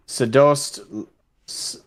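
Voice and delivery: usual speaking voice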